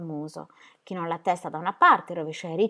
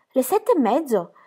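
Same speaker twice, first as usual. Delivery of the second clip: louder, higher startled question; surprised exclamation; 7 semitones higher, 6 dB louder than usual. A narrator's reading. Gaston realises the time, exclaiming in surprise. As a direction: react as if late already. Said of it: "esclamò Gaston".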